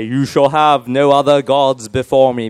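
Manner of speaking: slow, stately British voice of God; British accent. Acted. God speaks to Moses on the mountain, British accent. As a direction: proclaim it slowly in a put-on British accent. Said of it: "they speak with British accents".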